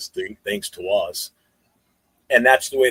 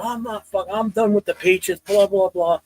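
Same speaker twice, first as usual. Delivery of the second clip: mocking the kid fan; 9 semitones higher than usual. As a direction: whine it like a sulking young fan. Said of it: "And he goes".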